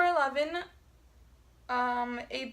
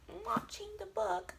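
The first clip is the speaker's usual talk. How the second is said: whispered, higher voice, playing dumb; mock whisper; 8 semitones higher, 6 dB quieter than usual. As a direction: whisper the silly slip back. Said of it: "I'm like".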